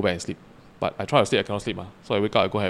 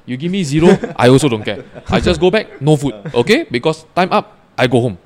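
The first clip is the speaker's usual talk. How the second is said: loud, punchy, higher-pitched refusal; retold defiant ultimatum; 3 semitones higher, 7 dB louder than usual. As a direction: lay down terms loudly, half amused in the retelling. Retold argument with the cookhouse sergeant.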